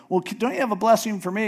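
high, rushed plea; pleading son. Retold or imitated. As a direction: beg quickly for a blessing. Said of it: "Esau's going".

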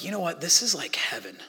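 hushed, breathless whisper of wonder; quiet, amazed whisper to himself; awed whisper. Retold or imitated. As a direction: whisper it like you've glimpsed heaven. Awed moment on beach, inner thought.

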